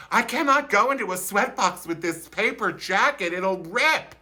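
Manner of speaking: high, prissy fret over the jacket; worried about the paper jacket; fussy worry. Retold or imitated. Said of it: "I thought".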